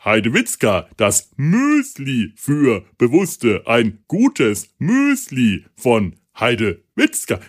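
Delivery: very slow, each syllable hammered; pompous commercial, drawn out; Shatner-style ad read. Retold or imitated. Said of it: "da wird geshatnert ohne Ende". (übertrieben betonte Werbestimme)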